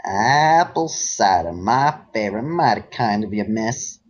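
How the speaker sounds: a folksy Southern cowpony sweet-talking a stranger; Braeburn southern accent. Acted. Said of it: "uh, southern accent".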